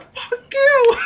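very high yelled retort; imitated crowd defiance; defiant heckle. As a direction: yell it straight back at the DJ. Crowd snapping back at DJ.

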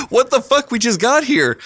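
can't believe it, just arrived; indignant disbelief. Retold or imitated. Narrator reacting to news they're kicked out, shocked.

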